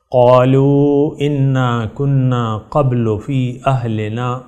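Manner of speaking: slow, drawn-out tilawat chant; Quran recitation. Acted. Preacher recites verses for listeners to repeat, slow chant. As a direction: recite each verse slowly, letting it ring.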